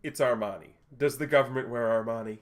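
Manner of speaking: loud, proud exclamation; indignant boast. Acted. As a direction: show off the suit, loudly offended. Lucha proudly shows off his suit.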